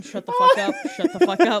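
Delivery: high, laughing shut the fuck up; shocked disbelief. Real, not acted. Host learns the Hoff has TikTok, laughing disbelief. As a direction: blurt it out in delighted shock.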